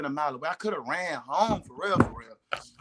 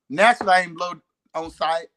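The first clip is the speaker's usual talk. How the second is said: ordinary talk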